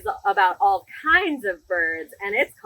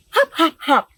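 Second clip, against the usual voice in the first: bright, lively storybook read-aloud; animated picture-book reading; reading to children; 9 semitones higher, 4 dB louder than usual. Acted. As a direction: read it big for a room of little kids.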